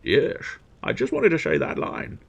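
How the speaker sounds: slurred Scottish impression with shushed s; hammed-up Scottish film star; Sean Connery. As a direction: turn every s into sh, Scottish style. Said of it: "Sean Connery popped in".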